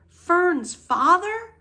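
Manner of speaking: high, slow, rising and loud, mildly tearful; sobbed question. A narrator's reading. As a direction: stretch it out in tearful disbelief. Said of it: "sobbed Wilbur".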